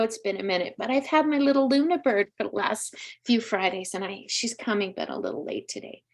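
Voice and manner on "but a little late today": a half-voiced aside that drops away; whispered aside. Real, not acted. Medium explains her daughter is running late, whispered aside. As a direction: whisper it under your breath, much softer.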